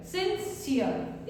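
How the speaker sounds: सिंसियर drawn out very slowly, syllable by syllable; slow clear demo. Shown as a demonstration. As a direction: stretch सिंसियर slowly so each sound is clear. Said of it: "इसका pronunciation रहेगा सिंसियर".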